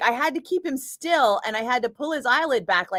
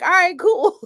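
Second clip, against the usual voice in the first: laughing reply; 11 semitones higher, 6 dB louder than usual. Retold or imitated. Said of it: "And he was like".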